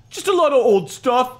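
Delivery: usual speaking voice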